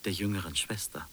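normal talking voice

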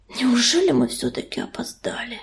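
soft, whispery husky breath; hoarse exhale. A narrator's reading. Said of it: "хрипло выдохнул он". (хриплый тихий выдох)

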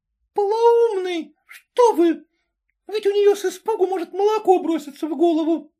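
высокий испуганный женский возглас; всплесни голосом, как старушка в ужасе; возглас с балкона. A narrator's reading. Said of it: "с балкона раздался возглас". (старушка с балкона, испуганно)

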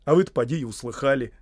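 excitable gardener's excuse; making excuses. Acted. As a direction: justify yourself eagerly to a master. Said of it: "оправдывался Сэм".

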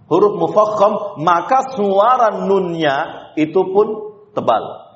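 ordinary talk

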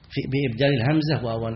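ordinary talk

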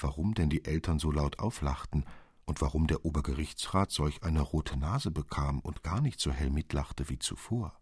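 ordinary delivery

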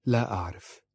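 mildly puzzled reply; understated confusion; bewildered. A narrator's reading. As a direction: answer at a loss. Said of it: "قالت في حيرة".

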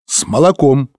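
declared reply with lifted pitch; answering aloud. A narrator's reading. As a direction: give the answer out loud, clearly. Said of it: "ответил Лэнгдон".

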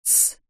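soft, voiceless "shh"; short hushed hiss for silence; hushing shush. A narrator's reading. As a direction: shush someone quietly, finger to lips. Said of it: "приложила палец к губам".

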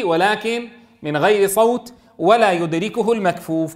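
ordinary talk, nothing marked

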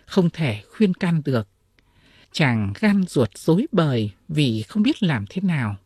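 ordinary delivery, nothing marked

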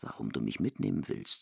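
normal talking voice

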